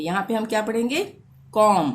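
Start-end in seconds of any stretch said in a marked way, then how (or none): 1.5-1.9 कॉम ending on the m, no b; loud, clear कॉम closing on m; silent B. Shown as a demonstration. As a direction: say कॉम with the final B left silent. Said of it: "बी को silent रखेंगे".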